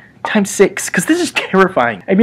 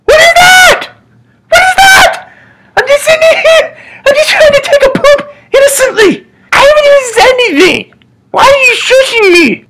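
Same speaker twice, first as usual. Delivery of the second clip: very high, loud whiny shout with laughter; indignant yell; 6 dB louder than usual. Pretend. Man on toilet yelling at the shusher in mock outrage.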